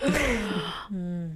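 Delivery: a sharp mock gasp of shock; imitated shocked gasp. Telling friends how her manager gasped, a mock gasp. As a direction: act out the manager's startled intake of breath. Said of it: "my manager was literally where her eyes like".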